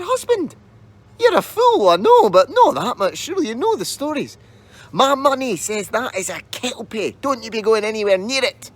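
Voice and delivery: high, scolding wife's voice; shrewish wife. A narrator's reading. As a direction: scold your foolish husband from up high. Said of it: "The wife said".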